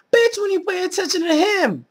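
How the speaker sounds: sudden high, loud retort; indignant outburst. Real, not acted. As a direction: fire it right back, outraged. Snapping back at mocked girl.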